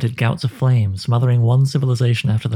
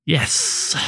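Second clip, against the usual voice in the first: soft, airy hiss, slightly higher; 4 semitones higher, 3 dB quieter than usual. Acted. The Hooded One answers Anduin's threat, soft sly hiss. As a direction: let the yes leak out as a hiss. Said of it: "it hissed".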